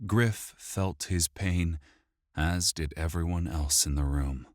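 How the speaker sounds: usual speaking voice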